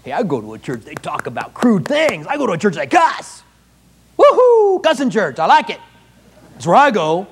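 hammed-up bragging churchgoer, whooping with glee; proud churchgoer. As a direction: brag like a gleeful fan. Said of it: "There'd be people who'd be proud".

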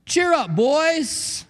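rally frightened fishermen cheerfully; hearty cheer up. Retold or imitated. Jesus calls to the scared disciples, high and hearty. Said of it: "What do you say to a boat".

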